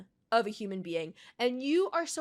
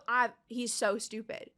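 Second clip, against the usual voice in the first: ordinary talk, nothing marked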